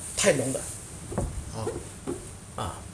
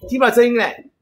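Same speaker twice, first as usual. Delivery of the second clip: loud, high-pitched call; 8 semitones higher, 9 dB louder than usual. Real, not acted. Host tells guest there is no sound, calling out. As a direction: call it out over the bad audio. Calls out about missing sound.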